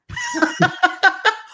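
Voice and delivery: short bright laugh; real amused laugh; high laugh. Real, not acted. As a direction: laugh at your own retelling. Laughs at retelling.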